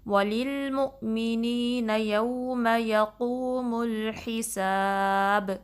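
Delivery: slow, drawn-out tajweed chant; measured Quranic recitation with long held vowels. Shown as a demonstration. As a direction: recite it slowly and melodically, stretching every vowel. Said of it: "با پہ آخر میں کلکلا کرنا ہے".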